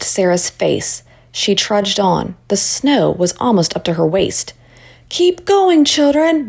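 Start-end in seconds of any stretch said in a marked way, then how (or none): 5.1-6.5 shouting. A narrator's reading. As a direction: push the children on. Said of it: "Miss Freeman shouted".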